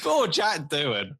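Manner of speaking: high, slow mock-pitying sigh of a line; exaggerated pity. Real, not acted. Podcaster pitying Doohan after the third signing, drawn-out sympathy. Repeats the phrase, more drawn out.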